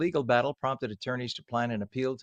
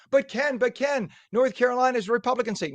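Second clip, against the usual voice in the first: higher, fast whiny objector; mock critic; 10 semitones higher than usual. Host mimicking a critic objecting to him, fast whiny mock. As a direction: rattle it off like a pestering heckler.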